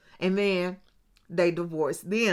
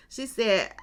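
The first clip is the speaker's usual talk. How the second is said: ordinary talk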